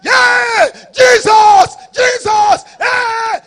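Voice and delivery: high, very loud cries of a panicking crowd; acted screams of alarm; imitated panicked shouting. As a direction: yell out like terrified passengers. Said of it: "come and see people shouting".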